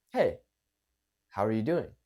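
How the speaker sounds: low, relaxed greeting with a pause after "Hey"; calm it down, let "doing" fall; calm, slowed down. Shown as a demonstration. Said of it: "Calming it down".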